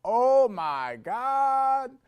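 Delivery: imitating her high, drawn-out yell; full-volume astonished holler, stretched out; top-of-lungs yell. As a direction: yell it out long, loud and high. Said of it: "she yelled, literally, at the top of her lungs".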